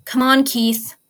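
loud desperate urging; will him back to life. Acted. Shiro shocking Keith's heart, loud desperate urging.

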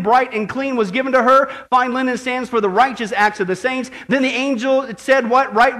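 energetic, emphatic scripture preaching; fervent preaching. Real, not acted. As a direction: proclaim the text to the whole church.

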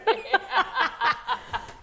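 high laugh through real tears; teary laugh. Real, not acted. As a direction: let a wet laugh escape. Laughing after admitting tears.

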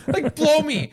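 sharp jump up in pitch, dismissive; scornful retort. Real, not acted. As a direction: throw it off with contempt.

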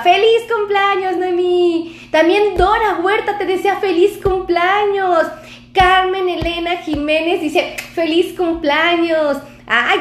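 high-pitched, drawn-out birthday cheer; excited. Real, not acted. Doctor relays birthday wishes to Noemí live, excited. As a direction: call out each greeting with real excitement. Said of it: "yo me emociono aquí".

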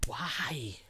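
whispered why. Real, not acted.

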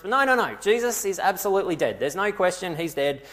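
loud, high burst of insistence; emphatic denial. Acted. Saul arguing himself out of believing Jesus lives, adamant. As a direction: shut the doubt down hard. Saul shoves the doubt away.

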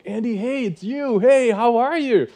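high, loud and drawn-out delighted hello; excited greeting. Retold or imitated. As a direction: greet an old friend you haven't seen in years, slowly and loudly. Joyful reunion after eight years.

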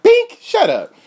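very high, loud snap; loud shut-down. Retold or imitated. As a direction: cut him off sharply. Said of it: "I'm like".

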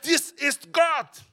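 slow, high and loud proclamation; solemn declaration. Acted. Preacher declaring what the blind man's words mean, awed. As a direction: spell out each word with awe.

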